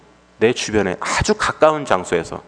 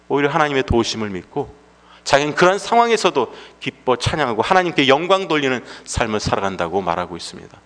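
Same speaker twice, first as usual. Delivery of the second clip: ordinary delivery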